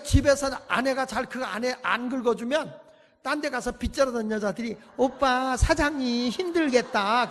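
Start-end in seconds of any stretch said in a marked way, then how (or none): 5.0-7.3 coaxing bar hostess. Retold or imitated. Bar women coaxing men.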